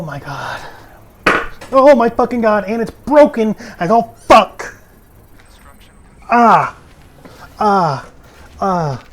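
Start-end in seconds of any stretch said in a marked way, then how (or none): 1.7-4.8 loud high angry yell; real frustrated shouting; furious outburst. Real, not acted. Game glitch frustration. 6.3-9.0 loud frustrated yells fading; real raging shouts; angry screams. Real, not acted. Game glitch frustration.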